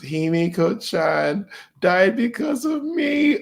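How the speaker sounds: high, drawn-out mock wail; hammed-up crying. Uraraka blaming herself for Himiko's death, hammed-up crying. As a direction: imitate a heroine bawling, stretching the words. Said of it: "she was proper, like, crying".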